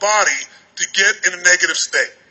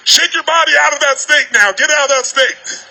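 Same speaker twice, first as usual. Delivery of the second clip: raised, higher-pitched crowd command; 4 semitones higher than usual. Real, not acted. Speaker stops a huge seminar crowd mid-exercise, commanding. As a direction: call the whole room to a halt. Calling a whole roomful to halt.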